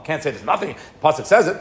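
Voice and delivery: ordinary talk, nothing marked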